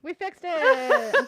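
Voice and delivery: loud, high, drawn-out cheer with laughter; gleeful triumph. Real, not acted. Streamer celebrating the audio fix, gleeful laughing cheer. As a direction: crow it slowly, breaking into a laugh.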